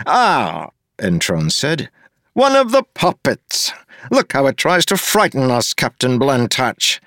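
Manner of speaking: a pompous official looking down on everyone; smug mayor. Acted. Mayor Gave demanding an apology from Wax, sneering.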